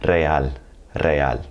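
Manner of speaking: Spanish "Real" with rolled R, re-AL; trilled initial R. Shown as a demonstration. Teacher models the cognate Real, trilled R. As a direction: roll the R starting "Real". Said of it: "you're gonna have to hit that trill".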